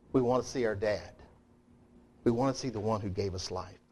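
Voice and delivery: usual speaking voice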